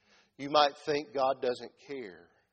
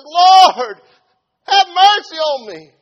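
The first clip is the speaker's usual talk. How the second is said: huge, high, drawn-out plea; crying out; 22 dB louder, much slower than usual. Acted. Mother begging the Lord for her daughter, crying out. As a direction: cry out to heaven at full voice. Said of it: "So she cries out some more".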